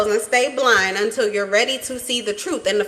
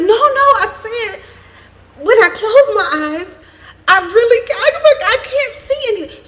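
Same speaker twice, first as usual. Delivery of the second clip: put-on insistent child; 13 semitones higher, 7 dB louder than usual. Granddaughter insisting to Grandma, high and loud with a giggle. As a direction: insist like a little girl who can't see. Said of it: "And she was like".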